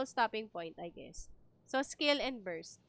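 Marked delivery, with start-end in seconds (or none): none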